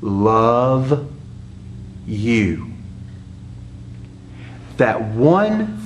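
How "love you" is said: each word alone, long pauses between; syllable by syllable. Shown as a demonstration. Pastor revealing the three-syllable phrase, word by word. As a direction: spell it out slowly, one word at a time. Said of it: "That three-syllable phrase is".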